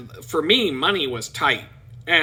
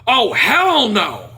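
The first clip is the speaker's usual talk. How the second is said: indignant outrage; 6 semitones higher, 8 dB louder, much slower than usual. Retold or imitated. Friend sees the deposit slip.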